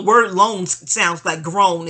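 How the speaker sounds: loans and groan drawn out and loud; slow loud emphasis. Shown as a demonstration. Telling viewers why loans scare her, slow and loud. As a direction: lean into groan, slowly. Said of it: "Not G R O W N, G R O A N".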